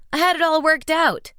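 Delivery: high, loud wounded yell. A narrator's reading. Tom yelling at Marcos, high and loud. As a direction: shout it like a rejected suitor. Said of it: "Tom yelled".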